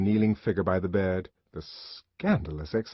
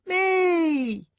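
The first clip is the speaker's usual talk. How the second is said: put-on high, loud child's yell; a chorus of eager kids; children yelling; 5 dB louder than usual. A narrator's reading. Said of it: "yelled the entire Bokanovsky group".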